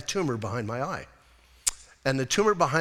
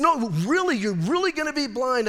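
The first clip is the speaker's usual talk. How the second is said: raised pitch, pressing the point; urgent insistence; 7 semitones higher than usual. Retold or imitated. Doctor pressing the bad news on patient, insistent. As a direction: imitate a doctor desperate to get through.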